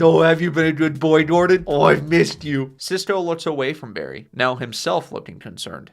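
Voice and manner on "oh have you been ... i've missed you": loud, gushing talk to a dog; doting pet talk. Acted. Barry greets his dog Gordon, loud doting fuss. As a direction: gush over a dog you've missed.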